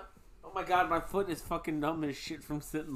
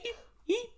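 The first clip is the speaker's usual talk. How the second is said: high squeaky hiccup eeps. Retold or imitated.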